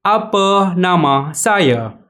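Apa nama Anda said very slowly, word by word; slow teacher demo; slow model phrase. Shown as a demonstration. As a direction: spell it out slowly for learners to repeat. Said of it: "مرة أخرى".

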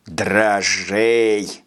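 low sneering mimicry; mocking nasty voice. A narrator's reading. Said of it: "противным голосом передразнил его Майков".

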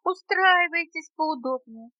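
high little insect voice; tiny hosts chiming in; small creatures' welcome. A narrator's reading. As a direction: pipe up a high, eager invitation. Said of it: "ответили хором жучок и паучок".

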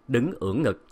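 ordinary delivery, nothing marked

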